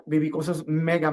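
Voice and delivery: ordinary delivery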